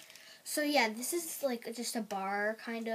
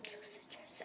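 whisper; 8 semitones higher, 16 dB quieter than usual. Real, not acted.

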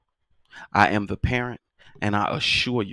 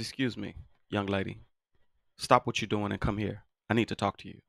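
softer, calm and controlled call to the daughter; calm, quiet summons; 8 dB quieter than usual. Retold or imitated. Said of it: "You should have seen me".

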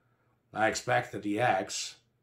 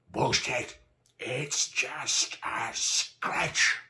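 higher, strained shout, words dragged out; dismissive yell; 5 semitones higher, 4 dB louder, much slower than usual. A narrator's reading. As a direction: yell it slowly, brushing off the injury. Comrade yells back at wounded man.

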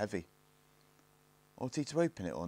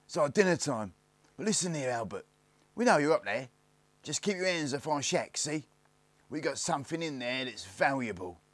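higher-pitched rough lad warning a hidden rival; eldest Snarget calling out; 5 semitones higher, 3 dB louder than usual. Acted. Eldest warns hidden Albert off the shack, raised calling voice. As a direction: call up a cocky warning, pitched high.

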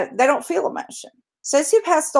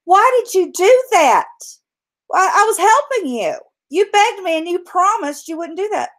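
higher, hurt disbelief; dying frog; 8 semitones higher than usual. Acted. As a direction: ask why with betrayed dismay. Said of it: "And the frog says, as he's dying".

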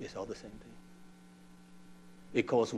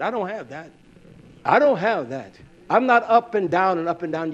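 loud, raised-pitch sermon delivery; forceful preaching; 6 semitones higher, 13 dB louder than usual. Real, not acted. Preacher driving home how a man learns to love, forceful preaching. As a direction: preach it hard, pushing the volume.

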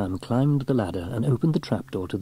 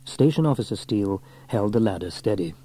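ordinary delivery, nothing marked

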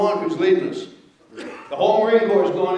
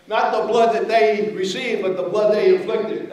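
ordinary talk

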